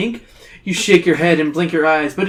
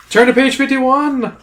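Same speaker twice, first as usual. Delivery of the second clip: higher, louder cliffhanger call; dramatic exclamation; 9 semitones higher, 5 dB louder than usual. A narrator's reading. Reader ending a tense passage, dramatic exclamation. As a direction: announce it with a dramatic lift.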